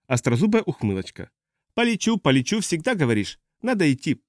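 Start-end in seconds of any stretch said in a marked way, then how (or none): 1.7-4.2 high, mocking echo of her promise; teasing old pilot. Old pilot doubts the captain's promise, high and teasing. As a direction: mimic the promise with lifted pitch.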